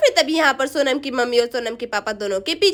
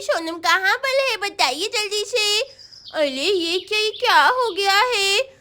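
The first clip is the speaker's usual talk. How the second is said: sing-song lisping schoolgirl; unhurried, puzzled child voice; puzzled little Chinki; 5 semitones higher than usual. Acted. Girl questions unresponsive uncle and aunty, puzzled child voice. Plays unaware friend Chinki.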